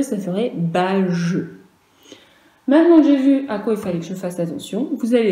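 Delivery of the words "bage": bage slowly split as ba-je; wrong soft G. Shown as a demonstration. Teacher warning children about G alone, slow soft-G reading. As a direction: draw out bage in two parts with a soft zh. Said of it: "si je mettais le G tout seul".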